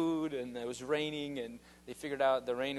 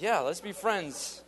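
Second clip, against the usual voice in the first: eager agreement; 6 semitones higher, 5 dB louder, slower than usual. Retold or imitated. His own quoted eager reply.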